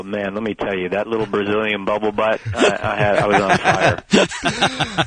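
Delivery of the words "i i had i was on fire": slow, laughing and much higher. Real, not acted. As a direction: laugh through the brag. Laughing while bragging.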